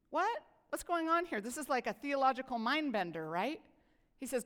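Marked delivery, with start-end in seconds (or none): 0.1-1.4 sharp higher "What?"; a quick startled yelp of confusion; mock surprise. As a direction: react as if baffled. Mock reaction to Jesus' answer.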